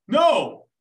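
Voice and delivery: mock American soldier insisting on a big coffee; pushy American tourist. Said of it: "the Americans were like".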